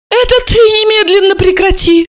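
high-pitched indignant yell; wailing yell. A narrator's reading. Mother to her pregnant daughter, wailing in outrage. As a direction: yell it back at the top of your voice. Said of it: "Альбина Яновна завопила".

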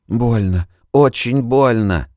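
high, strained cry of pain; tearful pain. Acted. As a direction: cry out that it hurts. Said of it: "брызнули слёзы".

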